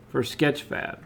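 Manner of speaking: ordinary delivery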